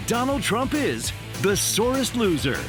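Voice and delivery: ordinary talk, nothing marked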